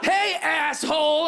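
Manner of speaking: slow, high, drawn-out yell; confrontational shout. Acted. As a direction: holler an accusation at a stranger. Heckler pops out of bush, yelling.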